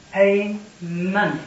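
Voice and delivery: slow, weighty emphasis on "money" for the jury; stress on money. Shown as a demonstration. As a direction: lean hard on "money", each syllable stretched. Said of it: "I've emphasized the word money".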